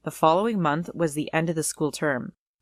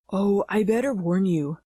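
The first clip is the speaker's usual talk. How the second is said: a hint of a put-on boy voice; girl faking a guy, barely lowered; lightly voiced fake guy; 2 semitones higher than usual. Sister in disguise warning the girls, light fake guy voice. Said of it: "she said in that guy voice of hers".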